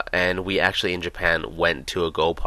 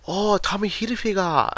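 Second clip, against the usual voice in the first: put-on high, slow Japanese-accented English; a Japanese man's heavy accent, pitched high; imitating Japanese accent; 14 semitones higher than usual. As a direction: mimic a Japanese shopkeeper's accent. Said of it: "not even joking about this accent".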